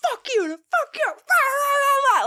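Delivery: very high, loud mock yelling; retold yelling. She yells at both drivers, high raging yell. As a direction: yell it out in pure rage. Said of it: "just yelling".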